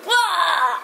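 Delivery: high mock wail of a falling goblin; goblin cry. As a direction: yelp it like a goblin toppling over. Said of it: "everybody was, like, going".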